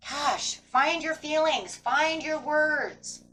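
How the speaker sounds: high, very slow, drawn-out plea; drawn-out exasperated plea; exasperated urging. Real, not acted. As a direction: stretch each word like you're losing patience. Exasperated urging after calling out.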